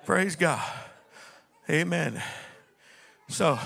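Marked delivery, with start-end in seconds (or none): none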